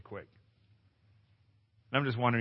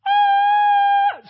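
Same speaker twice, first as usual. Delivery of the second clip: very high, loud falling scream; 14 dB louder than usual. Retold or imitated. Speaker reliving the drop, screaming loud and high. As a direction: let it rip like dropping off a cliff.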